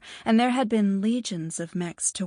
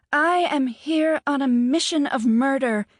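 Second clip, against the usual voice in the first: loud, higher melodramatic threat; mock-grand threat; 6 semitones higher, 7 dB louder than usual. A narrator's reading. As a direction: declare it like a villain.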